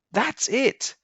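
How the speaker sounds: high, eager burst of discovery; excited. Acted. Boy realizing how to fly, high excited exclamation. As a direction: pitch it way up like a eureka moment. Boy's sudden idea.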